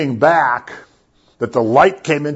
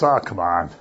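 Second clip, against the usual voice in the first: low, hushed coaxing; quiet, low-pitched nudge, trailing softer; tempting voice; 7 semitones lower, 5 dB quieter than usual. Retold or imitated. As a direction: play the voice that is not his, low and soft. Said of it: "you hear a voice that says".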